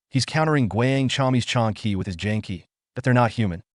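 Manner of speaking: ordinary delivery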